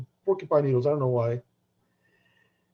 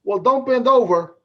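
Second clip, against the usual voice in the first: put-on slow, louder old lady punchline; 7 semitones higher, 7 dB louder, slower than usual. Old mother teasing son's friend, slow loud punchline. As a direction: drag out the joke, loud. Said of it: "She goes".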